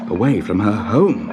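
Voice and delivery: ordinary delivery, nothing marked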